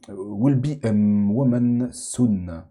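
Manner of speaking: slow, clumsy English accent on the song title; a Frenchman drawing out an English title in a shaky English accent; bad English accent. Real, not acted. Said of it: "désolé pour mon accent anglais qui est catastrophique".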